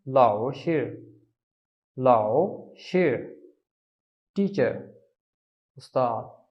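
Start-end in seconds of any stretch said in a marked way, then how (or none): 0.1-1.1 लाओ शिर said slowly, each syllable drawn out; slow Chinese lao shi. Shown as a demonstration. Teacher drilling लाओ शिर slowly for Hindi-speaking students. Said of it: "क्या कहते हैं?". 2.0-3.4 लाओ शिर stretched very slowly, falling at the end; very slow lao shi. Shown as a demonstration. Teacher repeating लाओ शिर very slowly so students catch it. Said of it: "क्या कहते हैं?".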